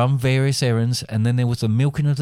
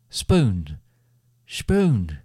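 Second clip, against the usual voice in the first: dreamlike calls building higher and louder; voices calling; 7 semitones higher, 3 dB louder, much slower than usual. A narrator's reading. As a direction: call the name, rising each time to a loud cry. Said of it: "she could hear voices calling her".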